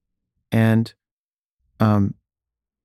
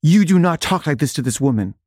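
stern, raised-pitch scolding; indignant telling-off, pitched up; mad; 6 semitones higher than usual. Retold or imitated. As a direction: act out an angry guard laying down the law. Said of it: "the security guard was mad".

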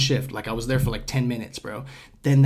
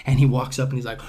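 usual speaking voice

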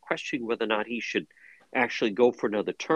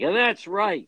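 high, louder mock off-script outburst; raised-pitch imitation of a politician ad-libbing; ad-libbing Biden; 9 semitones higher, 5 dB louder, slower than usual. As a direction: blurt it as a mock folksy ad lib. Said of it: "either says, like".

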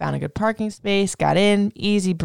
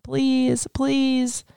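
higher, pleading please please please; begging; 8 semitones higher, much slower than usual. Retold or imitated. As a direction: replay the frantic plea at the closed door. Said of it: "I'm begging".

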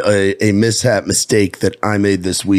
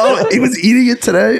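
laughing; 11 semitones higher than usual. Real, not acted. Laughing disbelief.

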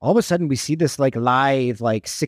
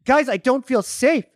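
mock plea; 8 semitones higher, slower than usual. Imitating man's fake plea.

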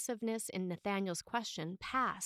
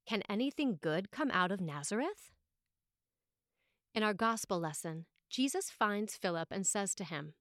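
usual speaking voice

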